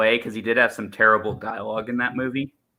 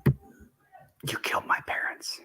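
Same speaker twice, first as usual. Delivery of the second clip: gravelly whispered Batman growl; 11 dB quieter, slower than usual. Acted. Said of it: "So that's my Batman one".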